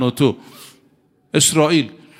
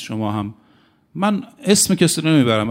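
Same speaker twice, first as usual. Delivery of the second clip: ordinary talk, nothing marked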